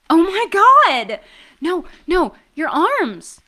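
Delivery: loud, high and slow gasp of shock; shocked shout. Acted. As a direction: cry out in alarmed surprise. Sees his arms inflate.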